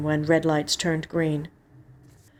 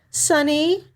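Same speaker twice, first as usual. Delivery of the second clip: sister's voice; 10 semitones higher, 3 dB louder than usual. A narrator's reading. Sister on phone, higher voice.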